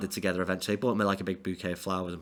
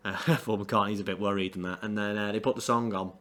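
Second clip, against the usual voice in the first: normal talking voice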